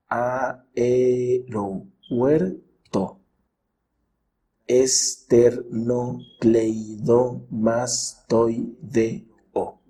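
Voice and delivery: each word broken into separate syllables; slow teacher's syllable division, pausing between beats. Shown as a demonstration. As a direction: sound out every syllable on its own. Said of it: "Observa estos ejemplos".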